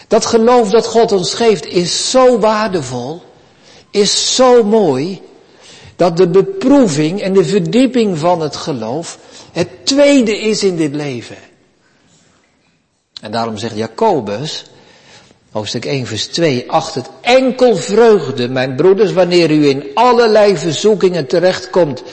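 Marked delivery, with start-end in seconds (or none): 0.1-11.4 higher, louder preaching build; raised impassioned sermon voice; fervent preaching. Acted. Preacher builds to full force. 16.7-22.0 emphatic scripture quote. Acted. Quoting James with force.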